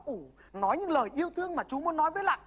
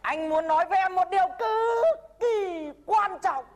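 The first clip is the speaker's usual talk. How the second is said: slow, raised, earnest declaration; solemn demo line; 4 semitones higher, slower than usual. Shown as a demonstration. The mentor demonstrating the love declaration, slow and solemn. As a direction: announce it like a grave confession. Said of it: "Mắt nhìn thẳng vào mặt Lạng mà rằng".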